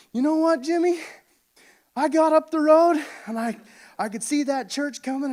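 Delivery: a father frantic at losing his son, hammed-up shout; hammed-up excited dad. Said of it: "and he's like".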